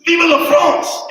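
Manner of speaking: high, loud, slow rallying shout; patriotic shout. Acted. Frenchman's last cry before the sword, loud and slow. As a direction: belt it out like a battle cry. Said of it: "he goes".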